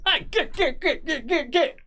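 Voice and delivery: high laughing squeal of "get, get, get"; flustered man swatting a creature off his face; shooing spite. Retold or imitated. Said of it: "Um, like that".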